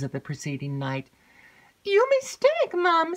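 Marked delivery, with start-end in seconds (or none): 1.8-3.1 high, loud eager maid's voice; breathless excited maid. Acted. Maid rushes in to tell Emily news, excited.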